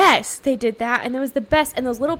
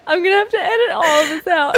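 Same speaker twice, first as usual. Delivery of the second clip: laughing; 4 semitones higher, 4 dB louder, faster than usual. Real, not acted. Both cracking up.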